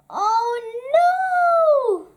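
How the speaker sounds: very high, loud terrified shriek; panicked little goat shrieking. Acted. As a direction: scream in sudden fright. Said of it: "shrieked Beanie".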